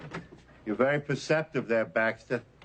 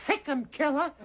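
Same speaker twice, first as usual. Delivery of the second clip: high camp sic 'em, a mocking effeminate squeal; high mock command to the dog; mincing command. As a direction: shriek the order like a mincing caricature. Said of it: "and says".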